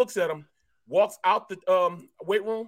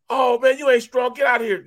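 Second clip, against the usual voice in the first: loud, raised taunt imitating a teammate; mock hollering; 6 semitones higher, 6 dB louder than usual. Lifter heckling teammate walking into weight room, mock holler. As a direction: holler it as a jeering put-down. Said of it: "he start hollering and cussing at Peasy".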